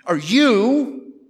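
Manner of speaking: high-pitched, incredulous stress on "you"; "you" punched high as a question; emphatic YOU. Shown as a demonstration. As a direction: lift "you" high and lean on it. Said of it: "it's emphatic".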